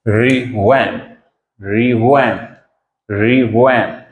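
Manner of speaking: रिवैम्प said slowly and clearly, stress on first syllable; teacher models रिवैम्प for students to repeat; pronunciation demo. Shown as a demonstration. Teacher modelling the word रिवैम्प for students, slow and clear. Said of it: "इसकी pronunciation है".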